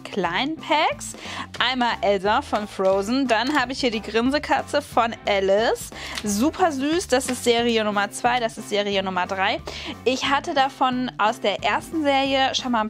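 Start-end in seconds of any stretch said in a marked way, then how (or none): none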